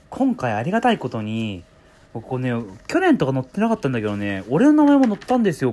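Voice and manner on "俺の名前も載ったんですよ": high, loud, proud burst; excited. Real, not acted. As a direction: announce it with glee. Proud of name in paper.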